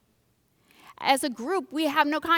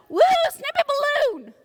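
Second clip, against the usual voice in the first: high, loud hammed-up cheer; mock crowd cheer; 7 dB louder than usual. Preacher imitates excited crowd at Snoopy balloon, mock cheer. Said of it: "we're like".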